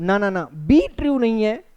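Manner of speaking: ordinary delivery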